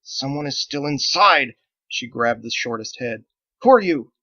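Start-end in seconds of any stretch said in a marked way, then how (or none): 0.0-1.5 slow, loud urgent cry; through clenched teeth. Acted. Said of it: "Andolin clenched her teeth". 3.6-4.1 higher, louder sharp demand. Acted. Andolin demands in the dark.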